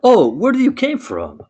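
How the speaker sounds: high startled gasp of a question; surprised. Acted. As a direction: jump up in pitch, amazed. Eliana meets the genie.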